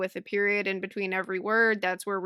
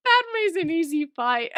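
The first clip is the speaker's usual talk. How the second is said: laughing through the words, a bit higher and louder; cracking up at the thought of an easy fight; 9 semitones higher, 5 dB louder than usual. Real, not acted. Podcast host laughs at her cohost's Padme theory, laughing.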